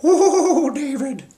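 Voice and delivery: giggly, high-pitched mock Indian accent; high drawn-out "ooh" in a playful Indian accent; imitated Indian accent. Guru giggles before answering the host, imitated giggly Indian accent. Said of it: "in his little Indian accent".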